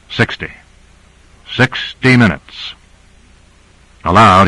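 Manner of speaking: ordinary delivery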